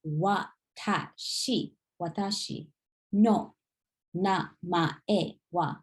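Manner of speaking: slow, careful watashi no namae wa; slow syllable by syllable. Shown as a demonstration. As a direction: spell it out slowly, each syllable clear. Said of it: "pronounced the way you see it".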